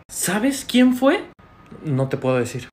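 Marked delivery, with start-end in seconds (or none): 0.1-1.2 very slow, high, drawn-out question; insistent question. Acted. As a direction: stretch it out, pressing for an answer.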